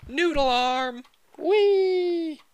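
high, loud silly voice; goofy whee. Pretend. Character flying with noodle arms, high silly squeal. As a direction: squeal it out like a flailing kid.

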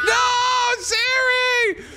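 very high, drawn-out screamed words; frantic scream; panicked screaming. Real, not acted. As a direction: shriek it in desperate protest. Screams while being attacked.

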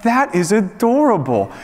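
high-pitched adoring coo; retold fatherly gushing. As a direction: melt at how cute it is. Father gushing over a sweet gesture.

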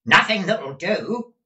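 high, loud, impatient reply. A narrator's reading. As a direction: shout the answer at her.

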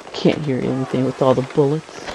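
ordinary talk, nothing marked

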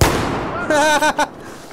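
sudden high-pitched laugh. Real, not acted. Sudden find of sniper rifle.

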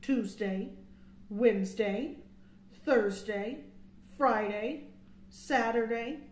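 plain reading voice

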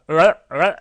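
loud, quick 랄 with the tongue curled then released; tongue-pulling R-to-L. Shown as a demonstration. Teacher shows the tongue pulling back, loud curled 랄. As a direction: punch out 랄, sliding from R into L. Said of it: "이렇게 해요".

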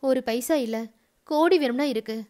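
usual speaking voice

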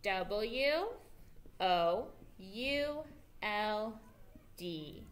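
drawn-out letters for the class; teacher slowly spelling the word for kids. Shown as a demonstration. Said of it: "Let's do it again".

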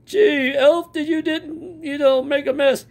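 high, hammed-up jolly Santa. Animatronic Santa greets the fans in a high jolly voice. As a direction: boom out ho-ho-ho like a toy Santa.

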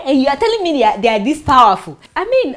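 usual speaking voice

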